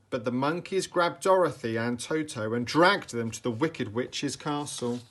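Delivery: ordinary delivery, nothing marked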